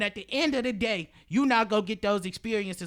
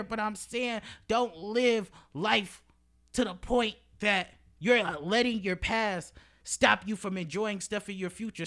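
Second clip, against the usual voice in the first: usual speaking voice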